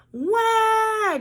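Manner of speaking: put-on high, loud baby wail. A narrator's reading. Baby crying after biting her own toe, high and loud. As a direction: wail like a newborn. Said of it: "Jackie Mackie cried".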